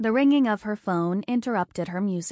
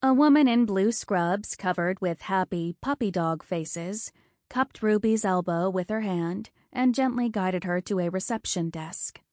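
ordinary delivery

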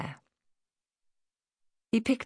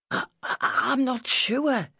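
high, stammering country lad; unsure boy; 9 semitones higher than usual. A narrator's reading. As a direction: stumble into a high-pitched doubt. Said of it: "she heard the boy say".

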